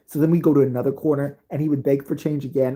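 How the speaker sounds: ordinary delivery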